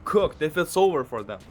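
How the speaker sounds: venting, almost shouting in disbelief; agitated rant. Real, not acted. Worked-up rant about young kids.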